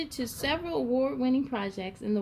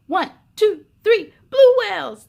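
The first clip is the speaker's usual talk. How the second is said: loud team chant, climbing high; shouted cheer; 8 semitones higher, 13 dB louder than usual. A narrator's reading. Team huddle cheering before the big game, loud shout. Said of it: "they shouted".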